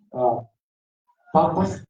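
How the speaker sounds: ordinary talk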